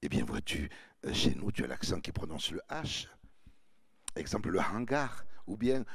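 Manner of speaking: low, smooth lecturing charm; charmer voice. Acted. A charmer explaining the accent to a girl, low and smooth. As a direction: purr it down low like a smooth talker. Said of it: "je continue le charmeur".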